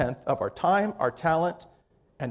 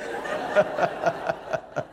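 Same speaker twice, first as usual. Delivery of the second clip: soft laugh; 3 dB quieter than usual. Real, not acted. Laughs after joke.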